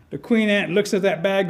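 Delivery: plain reading voice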